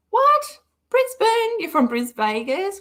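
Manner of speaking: shrill high disbelieving yelp; surprised. Real, not acted. Streamer shocked a viewer is from Brisbane, squealing high. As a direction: jump up in pitch at the news.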